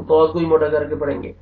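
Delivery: normal talking voice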